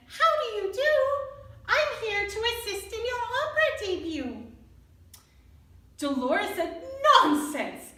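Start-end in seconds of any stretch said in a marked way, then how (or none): 0.1-4.5 high, small eager mouse; little mouse voice. Acted. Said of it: "when Fernando said". 7.1-7.9 haughty, dismissive nonsense; huffy dismissal. Acted. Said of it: "left in a huff".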